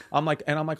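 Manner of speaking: usual speaking voice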